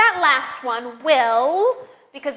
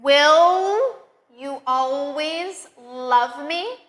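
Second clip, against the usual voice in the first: slow, clear sentences with the L on "will" held; slow model sentences; 3 dB louder, much slower than usual. Shown as a demonstration. Teacher models practice sentences for learners, slowly. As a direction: say each sentence slowly for repetition. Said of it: "practice several sentences with that".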